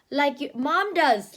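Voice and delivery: ordinary delivery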